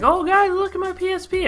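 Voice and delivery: put-on high, squeaky bragging kid voice; dorky kid bragging. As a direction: show off the toy in a goofy high voice. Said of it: "you're like".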